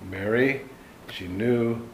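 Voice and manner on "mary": ordinary delivery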